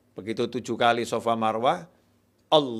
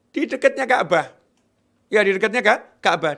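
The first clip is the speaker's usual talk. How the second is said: higher, louder emphasis; emphatic exclaim; 7 semitones higher, 6 dB louder than usual. Real, not acted. Preacher revealing where the water appeared, high and loud. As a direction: proclaim it with force.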